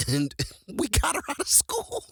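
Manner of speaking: high voice breaking into real laughter; laughing. Real, not acted. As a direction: barely get it out through laughter. Cracking up at own story.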